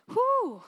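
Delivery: high, falling "whew!"; exclaimed whew. Real, not acted. Preacher moved by God's promise, high exclaimed whew. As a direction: let out a high, swooping whew.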